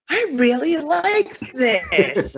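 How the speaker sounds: high, drawn-out enthusiasm; acted-out excited discovery; retold delight. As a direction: relish each word slowly, pitched well up. Retold delighted reaction to a song.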